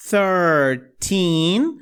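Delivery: thirteen with the tongue between the teeth for the th. Shown as a demonstration. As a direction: lisp the th in thirteen on purpose. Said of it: "A língua presa de novo". (thirteen com a língua entre os dentes)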